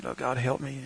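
soft, muttered plea; desperate prayer. Retold or imitated. Cramped traveler begging God for help, soft plea. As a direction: plead quietly under your breath. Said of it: "I'm just sitting there".

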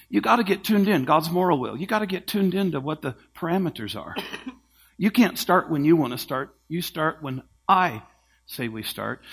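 normal talking voice

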